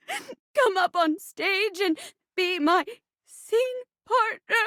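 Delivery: high, tearful stage heroine; melodramatic debutante. Acted. Debutante begging for a scene partner, hammy sobbing. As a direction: sob it out with theatrical gasps. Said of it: "a debutante trying to marry".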